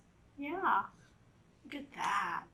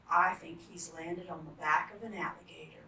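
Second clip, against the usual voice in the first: ordinary delivery, nothing marked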